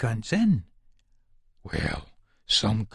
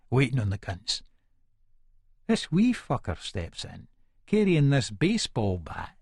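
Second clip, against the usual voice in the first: plain reading voice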